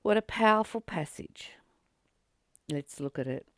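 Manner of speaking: ordinary talk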